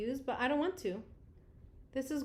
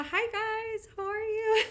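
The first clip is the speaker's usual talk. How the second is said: slow, high-pitched emotional greeting; close to crying; 10 semitones higher, 4 dB louder than usual. Real, not acted. Greeting the kids she misses, tearful high coo. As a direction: greet them high and drawn out, near tears. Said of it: "Oh, I'm gonna cry".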